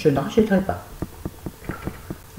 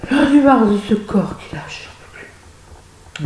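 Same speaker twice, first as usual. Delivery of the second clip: high outburst collapsing to a whisper; theatrical fed-up complaint, dying out; exasperated lament; 6 semitones higher, slower than usual. Acted. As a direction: wail in exasperation, then fade away breathless. Exasperated outburst at own clumsy body.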